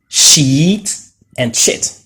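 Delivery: "sheet" long ee, "shit" short i; sheet versus shit. Shown as a demonstration. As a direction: contrast "sheet" and "shit" clearly. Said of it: "pronounced in a different way".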